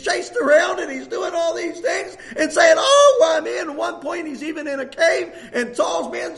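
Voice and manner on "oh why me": loud anguished cry of why me; mock-anguished wail; lament. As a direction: cry out like a man at the end of his rope. David lamenting, voiced in sermon.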